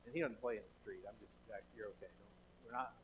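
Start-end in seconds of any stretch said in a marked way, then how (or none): 1.1-2.9 hushed word to his son mid-sermon; quiet aside. Real, not acted.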